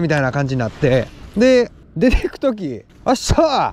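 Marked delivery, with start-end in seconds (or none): 3.1-3.7 high, clipped mock farewell call; keen shop clerk. Imitating the clerk seeing a customer out: high mock call. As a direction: bark out the clerk's goodbye, over-keen. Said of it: "っていう感じだったんですよ".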